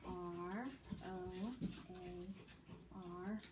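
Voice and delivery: very slow, quiet letter spelling. Shown as a demonstration. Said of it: "It might sound like".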